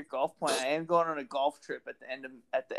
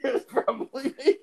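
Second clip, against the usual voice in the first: higher pitch, laughing through it; real slap-happy giggle on the words; giggling; 10 semitones higher than usual. Real, not acted. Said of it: "I'm a little bit slap happy".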